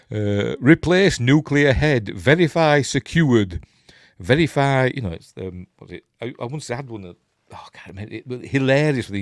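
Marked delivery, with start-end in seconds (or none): 0.6-4.9 loud, slow, declaimed procedure steps; exaggerated manual-reading voice; instruction read-out. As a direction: announce each step with heavy emphasis. Declaims odd on-screen instructions.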